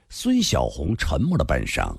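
ordinary delivery

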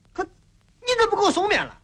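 high-pitched demand; too loud. Acted. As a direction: raise your voice in complaint. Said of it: "轻声点儿".

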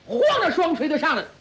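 ordinary delivery

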